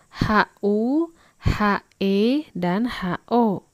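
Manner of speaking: normal talking voice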